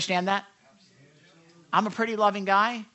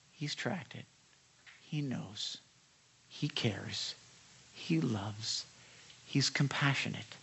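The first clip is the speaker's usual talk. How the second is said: soft and low, with long pauses; tender, reverent; 6 semitones lower, 7 dB quieter, slower than usual. Real, not acted. Preacher assures listeners that God cares, tender and hushed. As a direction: let each short phrase settle gently. Tender list of God's care.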